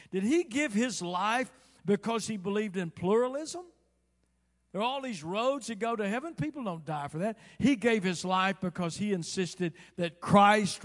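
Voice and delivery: loud, high rhetorical challenge; fiery preacher at full voice; impassioned preaching. Real, not acted. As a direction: hammer the argument home from the pulpit. Preacher argues at full force.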